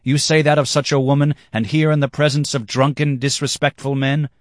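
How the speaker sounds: high, loud protest; indignant demand. A narrator's reading. Boy rebukes man before drunken guests, indignant demand. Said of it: "I demanded".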